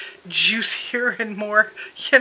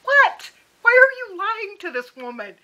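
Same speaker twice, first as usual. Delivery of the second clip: very high, loud exasperated outburst; mock-shriek of disbelief; tearing hair out; 11 semitones higher, 6 dB louder than usual. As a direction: demand it in frantic, high-pitched exasperation. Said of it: "I'm tearing my hair out".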